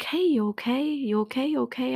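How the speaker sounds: high, light imitation of worried coworkers; concerned coworkers. Coworkers checking on her at the nurse's station, high concerned mimicry. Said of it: "And everyone's like".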